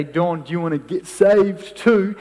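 loud teasing jeer at a mate; mocking jeer. Workmates teasing Don, loud mocking jeer. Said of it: "his mates start laughing at him".

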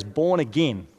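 a light Southern American drawl on the phrase; Southern American accent. Retold or imitated. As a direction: say it the way a Southern American preacher would. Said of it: "I always hear it in a Southern American accent".

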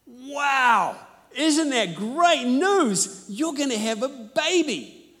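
high, loud and slow mock delight; bright fake enthusiasm, drawn out; forced cheerful. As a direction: beam through it with a big put-on smile. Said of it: "I put a big smile on my face".

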